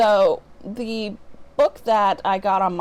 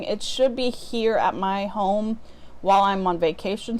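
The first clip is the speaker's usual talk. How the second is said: normal talking voice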